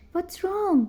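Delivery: high, loud worried shout; alarmed boy's yell. A narrator's reading. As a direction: call down in alarm. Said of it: "James yelled".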